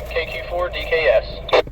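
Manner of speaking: usual speaking voice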